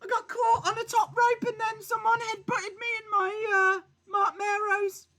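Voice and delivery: mock-miserable falsetto; weary bar voice. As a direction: sulk at the barman in a silly high voice. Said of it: "Why are you talking like that?".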